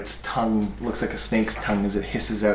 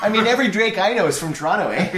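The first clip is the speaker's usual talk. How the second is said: fast, high mock Canadian; Canadian accent; 8 semitones higher, faster than usual. GM joking about Toronto drakes in a Canadian accent. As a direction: rattle it off like a Torontonian. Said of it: "full Canadian".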